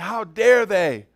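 loud, slow indignant cry; slow and outraged, voice rising in disgust; indignant outrage. Retold or imitated. Pastor retelling his anger at mission-trip photos, indignant and slow. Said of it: "I said".

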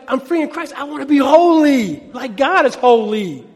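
loud, earnest, slowing at the end; earnest declaration. Retold or imitated. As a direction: declare it with conviction. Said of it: "Versus like".

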